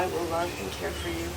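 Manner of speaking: softly fed vow line; quiet prompting. Real, not acted. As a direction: murmur the words for repeating. Officiant feeds vow lines.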